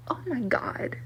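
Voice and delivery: breathy whispered oh my God, under the breath; hushed, stunned whisper; whispered disbelief. Real, not acted. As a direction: let it slip out as a stunned whisper. Disbelief muttered under her breath.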